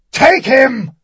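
hoarse, high, loud battle bellow. A narrator's reading. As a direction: roar the command, voice crackling. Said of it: "he bellowed again".

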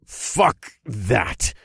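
defiant grunt against the wind; effortful grunt. A narrator's reading. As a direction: grunt it out while straining forward. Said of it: "I grunted as we struggled onward".

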